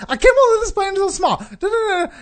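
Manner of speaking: fast, very high, loud mock griping; camp complaining passenger. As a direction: rattle it off in a hammed-up high whine. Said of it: "he has to gotta tell her".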